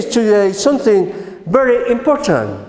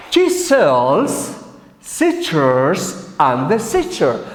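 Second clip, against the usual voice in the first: very slow, low, word by word; tongue-twister model; 5 semitones lower, much slower than usual. Shown as a demonstration. Teacher training learners' tongues with a twister, slow and deliberate. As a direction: stretch each word for learners to copy. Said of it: "Let's train our tongue, a tongue twister, with this one".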